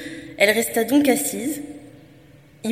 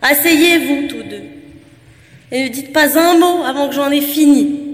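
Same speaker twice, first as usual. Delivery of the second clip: booming from a hollow shell; cavernous voice; 4 semitones higher, 8 dB louder than usual. Acted. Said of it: "d'une voix caverneuse".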